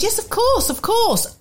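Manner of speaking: high-pitched, obliging reply; retold eager agreement. Host agreeing to take a passenger, high obliging voice.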